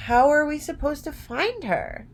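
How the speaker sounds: Dorothy asking. A narrator's reading. Dorothy's line in read story.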